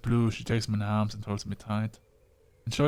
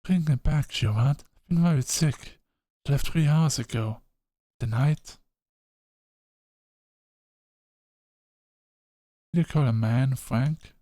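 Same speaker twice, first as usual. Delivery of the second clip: higher, louder anxious mother; worried mother voice; 4 semitones higher, 5 dB louder than usual. Acted. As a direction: call out like a frantic worried mom.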